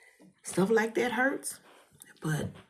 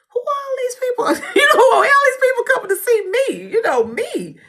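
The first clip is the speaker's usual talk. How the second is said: high, loud, half-laughing panic; panicked laughing cry; 15 dB louder than usual. Retold or imitated. Wondering who the crowds are, high, loud, laughing panic. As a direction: blurt it out high, loud and fast, laughing in disbelief.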